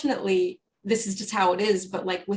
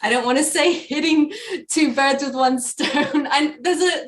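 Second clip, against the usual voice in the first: ordinary talk, nothing marked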